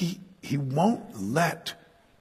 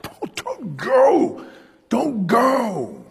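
high pleading wail, drawn out slowly; begging cry; 11 semitones higher, 5 dB louder, much slower than usual. Retold or imitated. Preacher voicing friends begging Paul, high crying plea. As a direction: beg him not to leave, near tears. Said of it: "began begging him".

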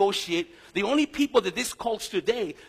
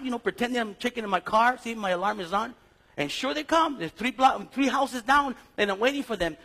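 normal talking voice

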